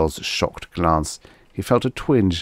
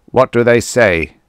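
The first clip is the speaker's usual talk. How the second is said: loud, higher-pitched demand; 5 semitones higher, 8 dB louder than usual. A narrator's reading. Old native chief demands a translation, demanding. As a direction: demand an answer loudly.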